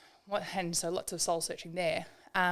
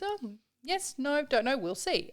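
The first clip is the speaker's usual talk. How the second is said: put-on wise old farmer; 8 semitones higher, 4 dB louder than usual. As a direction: lightly voice the shrugging old man. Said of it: "He goes".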